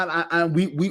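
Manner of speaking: ordinary talk, nothing marked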